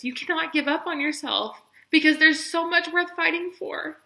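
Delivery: emotional plea, fighting tears; voice breaking with tears. Real, not acted. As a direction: push on through the start of crying. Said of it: "I hate crying".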